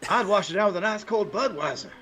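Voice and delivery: high-pitched put-on commentator voice; sportscaster impression. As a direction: join in with a mock ballgame-caller pitch. Co-host joins the Harry Carey impression.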